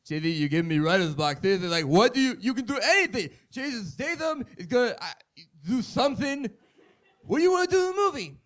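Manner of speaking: high, strained mock exasperation; a hammed-up Stallone at his wit's end; exasperated Stallone. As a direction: plead with him, losing patience. Frustrated Stallone voice.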